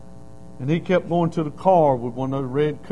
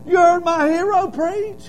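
delighted disbelief; 5 dB louder than usual. Retold or imitated. Preacher hearing of his hero.

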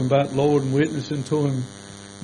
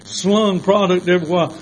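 ordinary talk

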